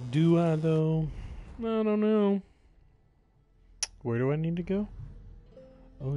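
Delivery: ordinary talk, nothing marked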